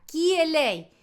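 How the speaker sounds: "Chi è lei" as kee-eh lay; Italian pronunciation. Shown as a demonstration. Teacher drilling "who is she", "Chi è lei" with k. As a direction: say "Chi" with a hard k. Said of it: "todos los ejemplos sin interrupciones".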